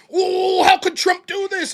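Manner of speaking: high, loud mocking whine; mock whining liberal. Mocking the other side to his co-host, high whiny cry. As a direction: mimic a whining liberal crying out.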